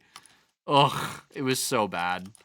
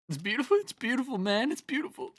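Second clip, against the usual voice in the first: much higher, softening, fake-weepy wonder; mock-tearful awe; 11 semitones higher, 3 dB quieter, faster than usual. To her viewers about germinating seeds, hammed-up teary awe. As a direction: gush like you're choked up over nothing.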